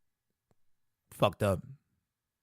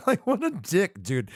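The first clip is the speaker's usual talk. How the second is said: mock outrage; 6 semitones higher, slower than usual. Mocking Goku in high put-on voice.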